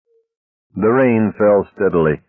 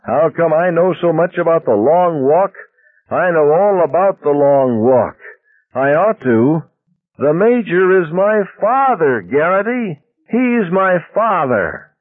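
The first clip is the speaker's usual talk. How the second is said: pulpit shout; 11 semitones higher than usual. Acted. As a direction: rise into a fiery pulpit shout. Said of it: "His low-pitched voice rose to a pulpit shout".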